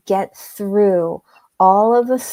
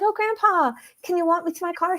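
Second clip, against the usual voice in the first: sweet granddaughter; 9 semitones higher, faster than usual. Retold or imitated. Asking granddad for a walk.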